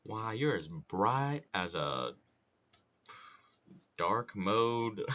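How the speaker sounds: slow Southern drawl with a searching pause; drawn-out Southern cartoon bluster; Foghorn Leghorn. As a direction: try out a drawling Southern rooster insult. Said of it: "doing that impression".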